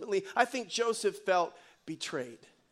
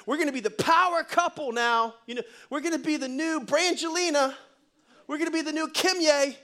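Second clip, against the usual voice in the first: raised pitch, playful hype; mock excited boast; 5 semitones higher than usual. Preacher mocks Joey dreaming of a power couple, lightly hyped. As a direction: talk it up like a showy announcement.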